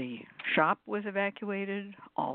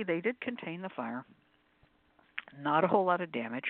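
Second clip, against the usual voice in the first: ordinary delivery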